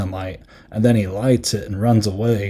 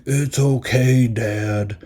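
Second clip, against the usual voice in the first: mock monster forgiving; much slower than usual. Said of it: "the monster's like".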